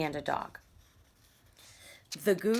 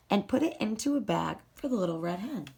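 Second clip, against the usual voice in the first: ordinary delivery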